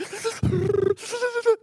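high, spluttering mock sobs; ridiculous crying noises. Shown as a demonstration. Boy being led down the foul line, ridiculous mock sobbing. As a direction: ham up a snotty, juddering cry. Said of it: "making all kinds of ridiculous noises".

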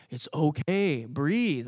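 slow, gentle reassurance; soothing coach. Acted. Coach to the crying young pitcher, slow and soothing. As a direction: talk a sobbing kid down slowly.

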